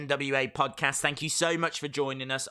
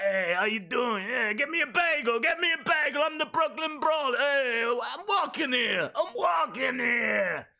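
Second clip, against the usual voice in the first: Brooklyn Brawler; 10 semitones higher, 3 dB louder than usual. Acted. Streamer mimicking a jobber for viewers, brash Brooklyn shout. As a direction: holler like a cartoon New York tough guy. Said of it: "That was my impression of the Brooklyn Brawler".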